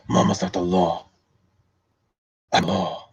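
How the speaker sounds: hushed, menacing lawman; Dredd. Acted. Streamer as the lawman claiming the law, Dredd voice. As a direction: lay down the law softly. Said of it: "I can do my Dredd voice".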